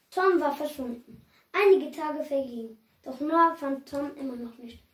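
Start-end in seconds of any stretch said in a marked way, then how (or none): none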